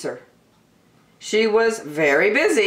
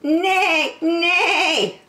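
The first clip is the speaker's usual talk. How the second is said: high-pitched horse whinny on a word; high, playful neighing horse voice; horse's neigh; 12 semitones higher, 4 dB louder than usual. Acted. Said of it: "said the horse".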